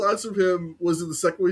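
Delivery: ordinary delivery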